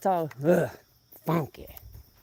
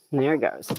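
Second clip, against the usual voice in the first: ordinary talk, nothing marked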